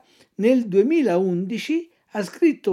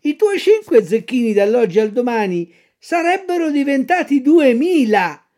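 higher fox voice, slowing on duemila; sly fox; 7 semitones higher, 8 dB louder than usual. Acted. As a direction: dangle the riches. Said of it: "la volpe".

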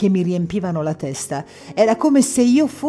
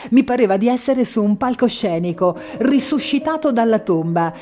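ordinary delivery

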